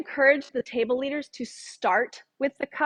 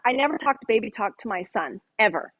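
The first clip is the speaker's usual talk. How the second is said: normal talking voice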